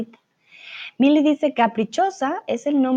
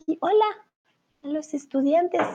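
puppet voice; 6 semitones higher than usual. Pretend. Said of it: "saludan a Brunito".

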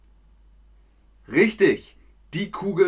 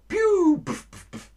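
shot sound effect; 3 dB louder than usual. Shown as a demonstration. Teacher imitates ball shot noises.

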